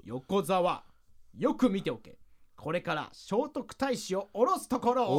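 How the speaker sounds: slow, grand pronouncement; commanding spirit. Acted. Spirit orders partner to watch the summoning, commanding. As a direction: declare it grandly, drawing it out.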